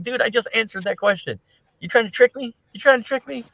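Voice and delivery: annoyed at the repeated questions; ticked off. Retold or imitated. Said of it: "He's like".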